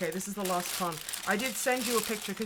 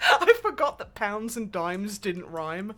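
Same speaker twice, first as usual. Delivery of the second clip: laughing; 4 semitones higher, 11 dB louder than usual. Real, not acted. Laughing at own flub.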